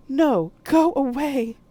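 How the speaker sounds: loud, high, slow drawn-out yell; frightened shout. Acted. Poe yelling at the ghost to leave. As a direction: push the ghost away with your voice.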